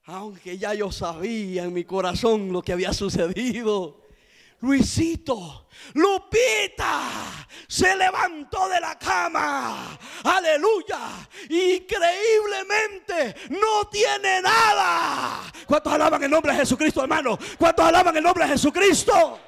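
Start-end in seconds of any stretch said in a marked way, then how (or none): 4.6-15.5 slow, high, loud words of joy with a cry in them; a mother crying out in slow, stretched joy; tearful joy. Retold or imitated. Mother telling of the miracle. 15.7-17.4 fast rousing call to the church; quick, loud call for praise; preacher's call. Real, not acted. 17.6-19.4 high, loud preacher's shout for praise; full-voice shout of praise; shouted call. Real, not acted.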